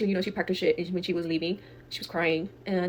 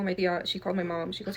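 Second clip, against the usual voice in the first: ordinary delivery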